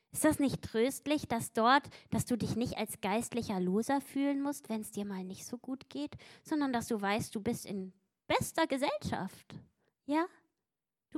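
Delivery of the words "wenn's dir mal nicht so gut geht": ordinary talk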